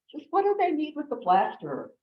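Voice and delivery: high-pitched puzzled punchline; mock bewilderment, pitched well up; baffled question. Duck's baffled question, the joke's punchline.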